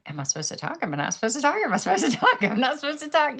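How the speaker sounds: fretting, rising in pitch and volume; anxious inner debate. Retold or imitated. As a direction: talk yourself into a nervous frenzy. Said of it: "I'm, like, going".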